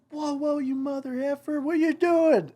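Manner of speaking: imitated high-pitched panicked yell; alarmed yell. Dad up in tipping bucket yells at son, high alarmed yell.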